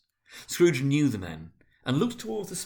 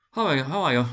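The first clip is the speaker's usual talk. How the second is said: a higher hearty greeting; first businessman, higher; 4 semitones higher, 7 dB louder, faster than usual. A narrator's reading. Businessman greeting his peer, high and hearty. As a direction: greet him up high, jovial. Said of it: "said one".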